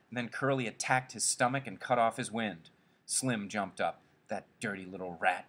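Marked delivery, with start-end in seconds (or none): none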